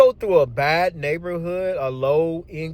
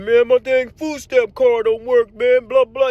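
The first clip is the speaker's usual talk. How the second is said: higher, louder mock rant; hammed-up griping buddy; ranting coworker; 5 semitones higher, 5 dB louder than usual. Coworker complaining his card failed, mock rant. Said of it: "He just going off on that".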